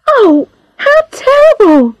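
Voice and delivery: high-pitched, horrified exclamation; shocked cry. A narrator's reading. Charlotte reacts to the doctor's reward, shocked cry. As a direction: cry out in horror, pitch leaping up. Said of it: "cried Charlotte".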